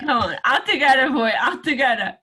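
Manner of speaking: laughing while coaxing; giggling through the words. Real, not acted. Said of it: "laughing so much".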